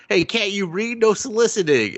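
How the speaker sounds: high, snappy scolding; mock grumpy homeowner. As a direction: shoo the kid off the porch.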